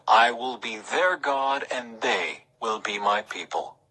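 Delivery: ordinary talk, nothing marked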